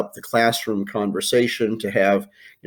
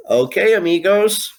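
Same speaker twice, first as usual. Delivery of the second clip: cheery call; 10 semitones higher, 5 dB louder than usual. Real, not acted. Teacher's cheery sign-in.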